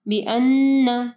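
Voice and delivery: बिअन्न with a long hummed double n; slow बिअन्न, lingering on the nasal n; ghunnah. Shown as a demonstration. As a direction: hold the nn of बिअन्न in the nose. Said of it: "गुन्ना होगा".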